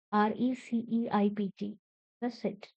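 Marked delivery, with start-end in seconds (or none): none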